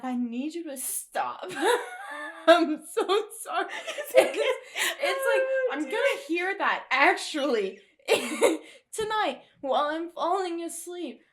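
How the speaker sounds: high, loud, crying with laughter; gasping through laughter; laughing. Real, not acted. Laughing fit about the laugh.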